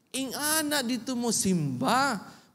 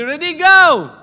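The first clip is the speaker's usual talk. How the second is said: shouted cue; energetic shout; 5 semitones higher, 16 dB louder, slower than usual. Real, not acted. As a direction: fire them up. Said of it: "Kulang pa energy".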